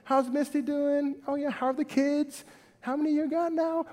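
acted small talk with a buddy; friendly conversation. Preacher catching up with his friend Troy, friendly and chatty. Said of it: "prayer is that conversation".

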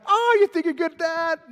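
high, loud taunt; hammed-up trash-talking jeer; mock smack talk. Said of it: "smack talk is not biblical".

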